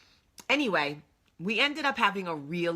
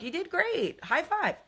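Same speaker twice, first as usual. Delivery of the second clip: much higher, bright cheerleader praise; upbeat cheer; 13 semitones higher than usual. Retold or imitated. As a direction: pump him up like a cheerleader. Cheering husband on after party.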